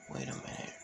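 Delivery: soft, breathy thinking aloud to herself; hushed, puzzled inner voice; inner thoughts. A narrator's reading. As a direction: whisper it like a private thought. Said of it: "that's her thinking".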